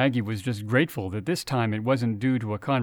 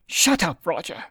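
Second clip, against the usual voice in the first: sharp, high angry hiss of a name; 6 semitones higher than usual. Acted. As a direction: hiss it at him to shut up. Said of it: "Betty hissed".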